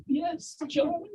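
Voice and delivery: put-on high, girlish reply; girl's voice. The girl answering his proposal, high and girlish. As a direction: squeak it out like a young girl. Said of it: "And then the girl says".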